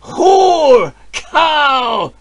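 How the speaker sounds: high, loud spiteful yell; jeering shout. Acted. Villagers yell insults at the shamed wife, spiteful shout. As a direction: hurl the insults after her. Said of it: "they shouted after her".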